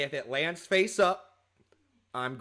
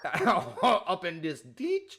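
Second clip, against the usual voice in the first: laughing; 5 semitones higher, 4 dB louder, much slower than usual. Acted. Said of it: "joking riff on the word".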